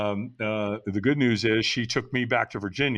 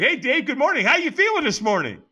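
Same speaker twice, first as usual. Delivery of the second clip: much higher, louder, chipper morning greeting; cheery caller; 12 semitones higher, 5 dB louder than usual. Retold or imitated. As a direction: play the overly cheerful sponsor calling early. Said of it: "He's like".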